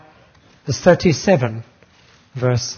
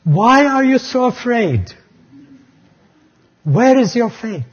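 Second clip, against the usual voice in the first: raised, searching question from the Lord; gentle rebuke; 7 semitones higher, 4 dB louder than usual. Retold or imitated. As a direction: ask it as the Lord would, lifted and firm. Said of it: "he asked them an incredible question".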